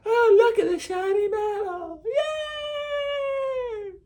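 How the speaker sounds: high, squealing fake delight over a shiny medal; hammed-up childish glee; mock excited gushing. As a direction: gush like a kid over a shiny toy. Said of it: "other people are like".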